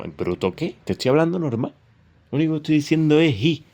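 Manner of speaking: high, indignant protest, fast at the end. Retold or imitated. Young man protesting to family, indignant and high. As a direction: protest that you're just talking normally. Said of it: "Y a lo".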